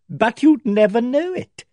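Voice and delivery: spring the twist with relish; sly glee. A narrator's reading. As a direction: Pye teasing the narrator. Pye's gleeful twist.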